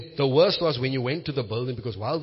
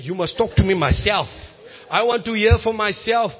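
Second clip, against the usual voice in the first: louder, higher, pleading demand to God; fervent shouted prayer; impassioned prayer shout; 4 semitones higher, 7 dB louder than usual. Retold or imitated. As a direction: cry it out boldly to the Father. Preacher voicing his prayer to God.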